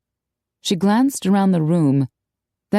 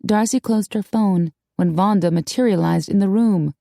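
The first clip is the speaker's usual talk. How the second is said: ordinary delivery, nothing marked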